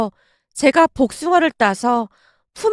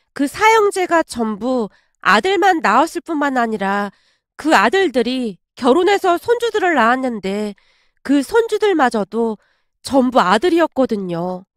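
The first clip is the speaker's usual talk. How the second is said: ordinary delivery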